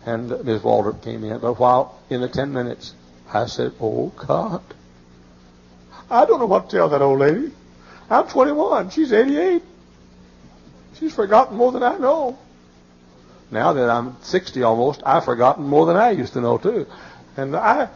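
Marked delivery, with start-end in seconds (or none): 3.8-12.4 high, loud frantic plea to God; desperate young preacher's prayer; panicked prayer. Retold or imitated. Panicked young pastor praying aloud.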